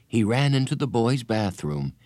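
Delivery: ordinary delivery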